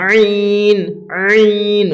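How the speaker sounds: আইন drawn out from a squeezed throat. Shown as a demonstration. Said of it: "গলাটা চাপ দেওয়ার মতন". (গলা চেপে ভিতর থেকে আইন)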